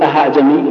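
أعجمي begun as "aha" instead of an eased hamza; h-sound error. Shown as a demonstration. Teacher rejecting a common mistake, h-sound in أعجمي. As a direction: put a clear h inside أعجمي. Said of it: "ولا تنطقها ها".

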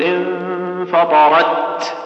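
slow, chanted انفطرت, T firmly closed then released in breath; press, then aspirate. Shown as a demonstration. Teacher showing correct recitation, press then aspirate. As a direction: draw out انفطرت, clamp the last T, then puff. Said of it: "والنطق الصحيح أنك تشد ثم".

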